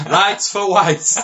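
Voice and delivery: slow, loud mock pub bigot.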